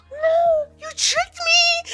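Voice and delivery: very high, loud, drawn-out squeal; gasp, then wail it like a duped fairy; mock-indignant shrieky protest; outraged fairy. Caught fairy protests, acted.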